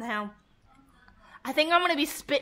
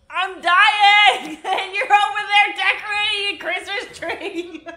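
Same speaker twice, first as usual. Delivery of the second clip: higher, louder, laughing in pain; 6 semitones higher, 6 dB louder than usual. Real, not acted. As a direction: crack up while it burns. Laughing through burning pain.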